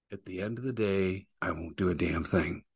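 softly admitted hypocrisy; muttered confession. Retold or imitated. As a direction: drop it to a sly murmur. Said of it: "I'll pretend to say the right things".

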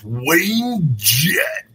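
big drawn-out rock-star announcer voice; hammed-up arena intro of a guest. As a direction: announce him like a stadium headliner. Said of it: "I don't know why I do that".

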